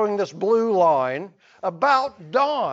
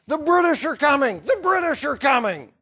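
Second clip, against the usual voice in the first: fast mock shout, a bit higher; retold shouting; 5 semitones higher, faster than usual. Rider shouting the alarm through the countryside, mock yell. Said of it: "Revere was shouting".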